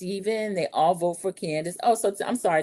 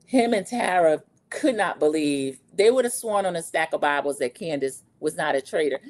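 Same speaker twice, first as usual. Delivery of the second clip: normal talking voice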